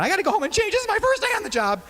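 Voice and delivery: mock upset customer. Scalded customer storms off to change, hammed-up falsetto. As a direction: squeal it like the drenched lady. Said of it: "she chewed me out".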